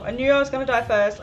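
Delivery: fast, loud wail of dismay; loud dismayed outburst. Real, not acted. Player dying first in the game, loud dismayed outburst. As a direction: blurt it out loudly in defeat.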